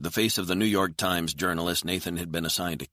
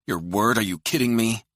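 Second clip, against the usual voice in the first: higher, louder incredulous retort; angry disbelief; 6 semitones higher, 6 dB louder than usual. A narrator's reading. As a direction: throw it back in angry disbelief. Hostage-like quarrel, disbelieving retort.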